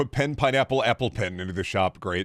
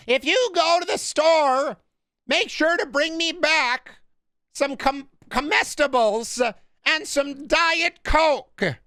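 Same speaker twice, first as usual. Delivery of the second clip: high, slow, halting impression; David Lynch; 4 dB louder, slower than usual. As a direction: draw out each phrase in a high put-on voice. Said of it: "the David Lynch voice".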